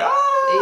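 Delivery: sudden high shriek of horror at the bill; mock horrified yelp; panicked yelp. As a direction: yelp like you've just seen your bank balance. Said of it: "I'm like".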